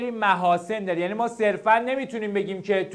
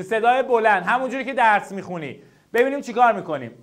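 ordinary delivery

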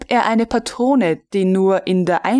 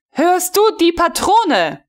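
higher, louder exasperated shout; 10 semitones higher, 4 dB louder than usual. A narrator's reading. Frau von Melin yelling at the staring recruit, exasperated. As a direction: yell it into his blank face.

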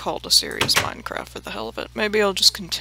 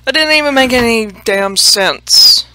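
loud, raised-pitch burst of self-exasperation; exasperated outburst; 4 semitones higher, 14 dB louder than usual. Real, not acted. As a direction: blurt it out loudly at yourself. Exasperated at own muddled remark.

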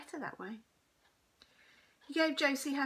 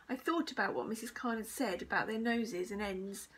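usual speaking voice

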